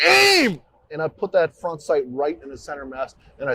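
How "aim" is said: much higher, louder retold yell; reliving the moment, he shouts it; shouted command. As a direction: yell the order at full force. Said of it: "And I was like".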